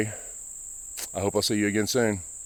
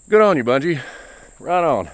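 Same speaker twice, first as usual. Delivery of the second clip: excited; 6 semitones higher, 5 dB louder than usual. Real, not acted. Enthusiastic praise for the developer.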